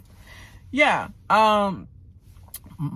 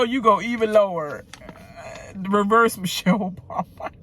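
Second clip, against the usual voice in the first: ordinary talk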